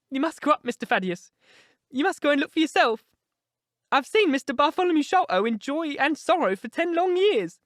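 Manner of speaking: put-on high, anxious old-woman voice; distressed old housekeeper. A narrator's reading. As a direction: voice a worried housekeeper, pitched high.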